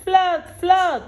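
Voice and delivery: high, loud mock alarm; crying flood. Cat shouting a fake flood warning, high and loud. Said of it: "he cried".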